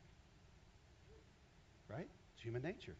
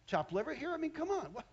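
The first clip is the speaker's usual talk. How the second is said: higher, louder sassy offended woman; indignant Eve; 8 semitones higher, 6 dB louder than usual. Acted. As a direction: huff it out, insulted and sarcastic. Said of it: "she's thinking".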